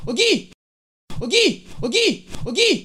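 calling out. Acted. Calling out repeatedly.

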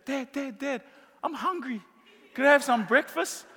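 put-on small child whining for breakfast; kid begging dad. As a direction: play the hungry little kid at the door. Kids voiced begging at bedside.

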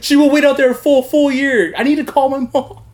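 loud, high-pitched mock panic; mock frantic plea. Prisoner begging to call his mom, mock frantic. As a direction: plead urgently, hammed up.

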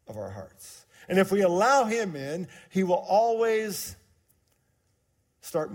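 preaching. Acted. Pastor telling the church about Jesus, emphatic. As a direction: drive the point home to the room.

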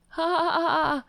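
high, forced "ha ha ha ha"; hammed-up mock laughter; mocking fake laugh. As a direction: laugh derisively on purpose, pitched high. Mocks the post just read.

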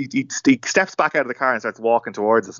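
normal talking voice

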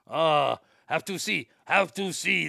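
put-on mock Korean accent, drawn out; clumsy jokey Korean accent impression; mock Kim Jong-un Korean accent. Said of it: "I imagine he goes there every fucking week".

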